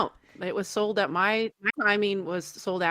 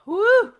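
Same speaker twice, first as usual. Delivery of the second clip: high, loud woo; excited woo; 12 semitones higher, 9 dB louder than usual. Acted. Recalling that one shot, high woo. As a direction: whoop at the memory.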